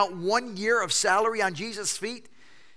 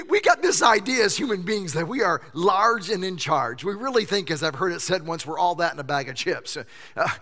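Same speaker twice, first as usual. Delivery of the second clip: usual speaking voice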